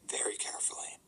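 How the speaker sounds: hushed, tiptoeing whisper; careful whisper. Retold or imitated. Employee on the last attempt at the code, whispering. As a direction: whisper it with great care.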